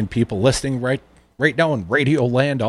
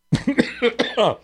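choking cough; 9 semitones higher than usual. Acted. Radio host choking on his own news copy, sputtering cough. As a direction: choke and cough mid-sentence. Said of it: "choked on that".

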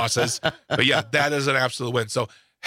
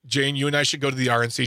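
normal talking voice

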